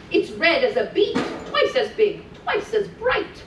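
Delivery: put-on lilting read-aloud for small children; sing-song storytelling voice; storybook reading. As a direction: read it like a bedtime story to little ones. Mrs Claus reads Rudolph aloud to children.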